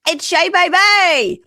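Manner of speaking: slow, high, loud cocky drawl; smug party guy intro; party bro. Retold or imitated. Podcaster imitating a cocky guy introducing himself. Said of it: "was like".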